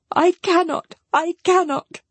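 high, anguished refusal; acted despair in a high voice; distressed cry. A narrator's reading. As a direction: cry out helplessly, pitched right up. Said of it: "cried Marianne".

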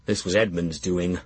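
plain reading voice

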